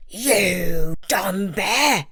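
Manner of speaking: slow, drawn-out sneering tiger; scornful tiger. A narrator's reading. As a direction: sneer it down at the bear. Tiger insults bear.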